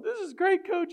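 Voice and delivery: very high, loud mock-tearful cry; hammed-up high-pitched player voice. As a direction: imitate an overwhelmed kid squeaking to his coach. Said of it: "He starts crying".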